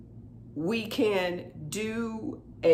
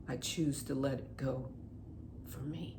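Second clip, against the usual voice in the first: much softer, barely there; fading soft release; 3 semitones lower, 13 dB quieter than usual. Acted. Closing the tapping round, fading softly. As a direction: let the phrase dissolve to a hush.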